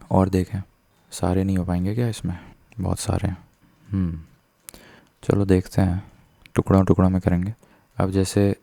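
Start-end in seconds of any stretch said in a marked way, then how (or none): none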